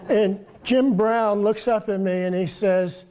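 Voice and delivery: ordinary talk, nothing marked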